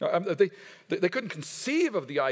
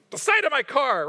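incredulous repeat; 7 semitones higher, 6 dB louder than usual. Retold or imitated. Preacher mocking a worker's excuse, incredulous and louder. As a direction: pitch jumps up, louder, disbelieving.

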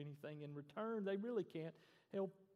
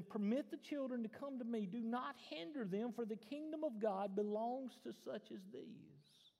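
higher, firm mild rebuke; understated indignation, voice raised in pitch; indignant Jesus; 6 semitones higher than usual. Acted. Jesus correcting his disciples, mildly indignant. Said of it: "Scripture says he was indignant".

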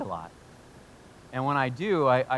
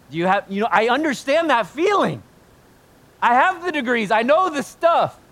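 high, loud preaching burst; impassioned outburst; 10 semitones higher, 8 dB louder than usual. Acted. Preacher admitting he has the degrees, impassioned. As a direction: raise voice and pitch, building to the end. Preacher owning his pride in knowledge.

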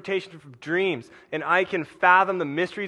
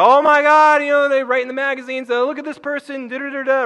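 much higher, much louder, swooning; mock the gushing magazine praise; over-the-top celebrity gush; gushing fans; 10 semitones higher, 9 dB louder than usual. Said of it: "and they go".

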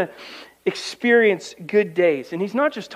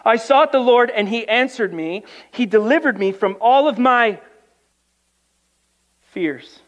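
raised, louder psalm reading to the church; preaching scripture; 5 semitones higher, 5 dB louder than usual. Acted. Preacher reading a psalm to his congregation, raised and louder. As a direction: proclaim the verses to the congregation.

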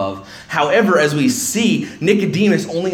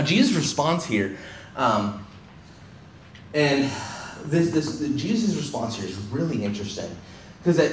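ordinary talk

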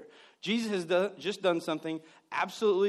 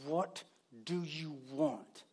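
weary exasperation; 5 semitones lower, 8 dB quieter, much slower than usual. Retold or imitated. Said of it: "he wants to say".

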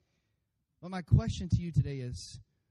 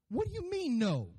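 high-pitched mock outrage at a refusal; protesting child; 11 semitones higher than usual. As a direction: whine it high like a kid told no. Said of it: "We don't like no".

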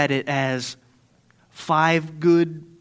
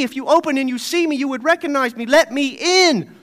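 higher, louder, desperate begging; pleading at the door; 7 semitones higher, 6 dB louder than usual. Acted. Shut-out believer begging Jesus at the door, pleading. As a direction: beg to be let in.

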